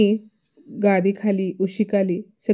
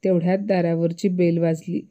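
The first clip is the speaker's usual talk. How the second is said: ordinary delivery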